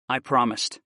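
plain reading voice

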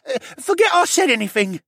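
put-on high, loud panicked boyish voice; near shout. A narrator's reading. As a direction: blurt it out high, almost shouting. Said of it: "Rylan nearly shouted".

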